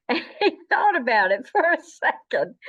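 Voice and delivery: ordinary talk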